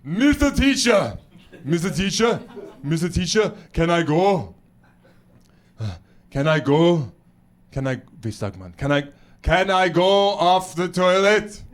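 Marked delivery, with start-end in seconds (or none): 0.0-8.0 put-on insistent Austrian-accented kid; halting, thick-accented schoolkid plea; little Schwarzenegger pupil. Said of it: "And they go". 8.8-11.6 put-on slow, urgent Austrian-accented kid; drawn-out, thick-accented plea; little Schwarzenegger pupil. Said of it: "And they go".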